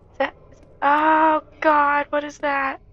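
high, loud, slow frightened cry; real scared shout; panicked yelp. Real, not acted. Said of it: "I'm scared".

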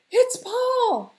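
very high, loud cry of recognition; scream. Acted. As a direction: scream out who it is. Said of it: "she screamed".

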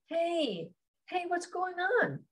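high, soft, slow comforting voice; gentle concern. Acted. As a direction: softly coax a friend to open up. Friend comforts the upset narrator.

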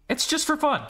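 high, sing-song mock repeat; high mocking echo. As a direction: parrot it back in a high voice.

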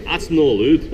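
"That's no allowed" in Scots with "no" rising; Scottish accent. Acted. Jester scolding the deer in a Scottish accent. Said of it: "soft, but hopefully decisive Scottish dialect".

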